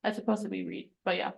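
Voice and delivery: normal talking voice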